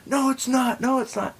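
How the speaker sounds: very high mock-frantic denial; irrational, frantic man. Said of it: "This guy is being completely irrational".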